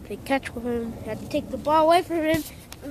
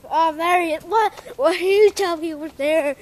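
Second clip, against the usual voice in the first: loud, higher excited shout; excited outburst; 5 semitones higher, 12 dB louder than usual. Real, not acted. Vlogger spotting the dog and shouting, loud excited outburst. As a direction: burst out loud and high.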